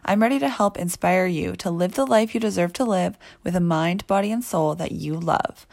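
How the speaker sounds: loud, bright host opener, pumping up listeners; upbeat podcast intro. Acted. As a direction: hype them up like a show host.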